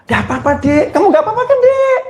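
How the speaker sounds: put-on high, sugary reassurance to someone else's child; sweet mother to guest's child. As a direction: fuss sweetly over the friend's boy. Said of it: "Marah atau bilang". (ibu manis menenangkan anak teman)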